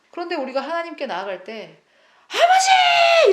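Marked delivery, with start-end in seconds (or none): 2.3-3.3 a long, very high, loud wail of father; crying out. Retold or imitated. Believer approaching God, drawn-out very high loud cry. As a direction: howl it out to heaven.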